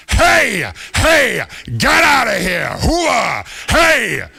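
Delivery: loud, high, barked Boston shout. Retold or imitated. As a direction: holler it like a seal guarding the house.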